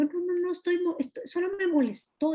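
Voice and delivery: high, acted-out excuse; dismissive denial. Showing how one denies being angry, high dismissive denial. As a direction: wave it away in a high voice. Acts out denying one's anger.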